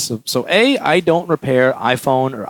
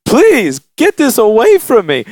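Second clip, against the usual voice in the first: high, louder, hammed-up rejection; exasperated refusal; 8 semitones higher, 5 dB louder, slower than usual. Repairer handing the customer's phone back, loudly exasperated. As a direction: push it away loudly, in mock desperation.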